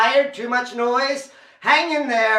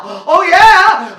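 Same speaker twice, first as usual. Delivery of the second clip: loud and higher, whooping the crowd on; loud excited crowd cheer; loud rally; 5 semitones higher, 14 dB louder than usual. Real, not acted. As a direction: pump them up, louder each time. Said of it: "a little bit louder now".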